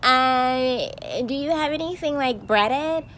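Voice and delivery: high, prissy imitation of a clueless customer; lightly sneering customer impression. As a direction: put on a mocking high voice.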